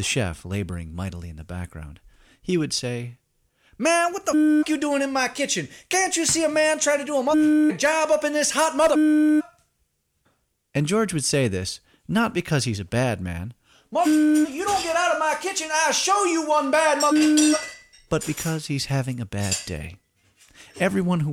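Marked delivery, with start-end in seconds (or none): 3.8-9.4 high, strained furious cook yelling; angry chef voice. Acted. 13.9-17.6 high, strained threatening cook; angry chef voice. Acted.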